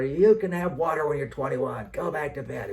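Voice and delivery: high, loud, fed-up refusal; angry yell. Retold or imitated. Father refusing the kids water, loud and angry. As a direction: snap at the kids to go back to bed.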